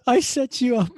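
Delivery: high, loud laughing confession; laughing tease. Real, not acted. Teasing the nervous co-host, laughing. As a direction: own up through laughter.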